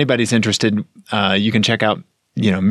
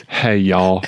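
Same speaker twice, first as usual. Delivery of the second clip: Southern greeting; 5 semitones lower than usual. Retold or imitated. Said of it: "starts off with".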